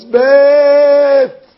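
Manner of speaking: loud high cry; tearful scream. A narrator's reading. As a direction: scream it back with tears. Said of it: "screaming with tears coming down".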